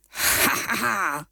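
mocking bully cackle; sneering laugh. A narrator's reading. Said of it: "ricane Gros Biquet".

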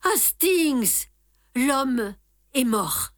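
a moved detective breaking grim news; strangely moved. A narrator's reading. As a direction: let the emotion catch in the voice, slowly. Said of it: "étrangement émue".

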